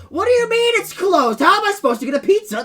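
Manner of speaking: high, loud, fast outraged yell; yelling at phone. Acted. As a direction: rant at the answering machine. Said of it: "Brooks is yelling at the phone".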